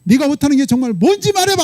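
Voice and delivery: high-pitched, hammering rhetorical questions; God pressing hard. Retold or imitated. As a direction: scold as an acted God, pushing for answers. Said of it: "하나님도 막 이렇게 몰아붙일 수 있나 봐요".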